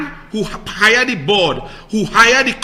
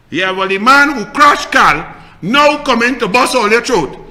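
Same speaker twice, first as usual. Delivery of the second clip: normal talking voice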